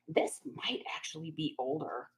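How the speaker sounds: whispering to herself; hushed aside, breathy whisper. Real, not acted. Examining an item closely.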